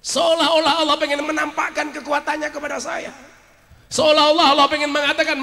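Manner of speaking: high, loud preacher's proclamation; impassioned preaching. Real, not acted. Preacher telling of God showing His power, impassioned preaching. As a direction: proclaim it with full force.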